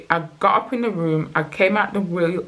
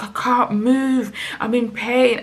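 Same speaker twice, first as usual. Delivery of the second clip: retold cry of pain, pitched higher; pained distress; 6 semitones higher than usual. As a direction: act out being stuck in agony. Retelling being struck by crippling pain.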